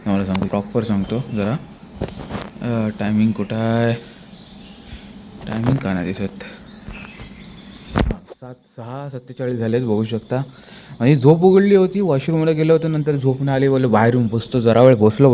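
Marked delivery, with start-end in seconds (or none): 0.1-10.4 softer, hushed morning talk; quiet at night. Real, not acted. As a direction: keep it soft, others sleeping. Early morning, everyone still asleep.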